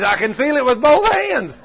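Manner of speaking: high, loud amazed exclaim; excited cry. Retold or imitated. Preacher voices healed woman, high, loud and amazed. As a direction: cry out in wonder. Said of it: "screamed again".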